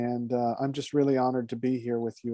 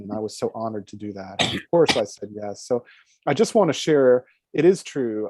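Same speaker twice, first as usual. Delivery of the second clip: ordinary delivery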